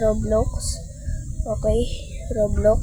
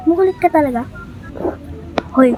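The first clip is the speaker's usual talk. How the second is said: normal talking voice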